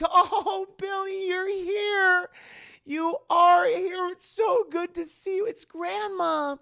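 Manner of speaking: hammed-up delighted grandmother; overjoyed grandma. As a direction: greet a lost grandchild's spirit with overwhelming joy. Grandmother overjoyed at spirit's arrival.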